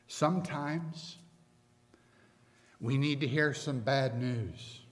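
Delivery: ordinary talk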